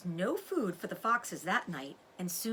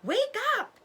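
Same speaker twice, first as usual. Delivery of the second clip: much higher, loud urgent cry; shout; 7 dB louder than usual. A narrator's reading. Father fox wakes his sleeping family, urgent shout. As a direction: wake the family in alarm. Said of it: "he shouted".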